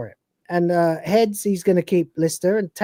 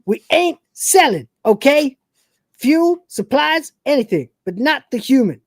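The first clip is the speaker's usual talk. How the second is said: higher cocky Cat voice; cat breaking character; 7 semitones higher than usual. Retold or imitated. Cat refusing the trade in his own higher voice. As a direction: snap back high and smug. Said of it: "breaks character and goes".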